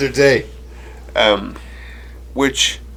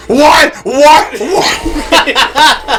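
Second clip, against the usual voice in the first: much higher, louder exasperated what; fed-up mocking yell; 14 semitones higher, 8 dB louder, much slower than usual. Uncle turning around, fed up, mocking the boy's shouted what. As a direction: throw the word back, fed up. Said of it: "he was fucking fed up".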